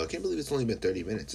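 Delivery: ordinary talk, nothing marked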